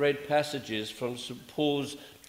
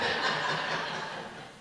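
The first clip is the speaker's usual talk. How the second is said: quiet breathy chuckle; soft laugh; 3 dB quieter than usual. Real, not acted. Preacher joking with congregation, soft laugh. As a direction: chuckle at your own secret. Laughing about hymn words.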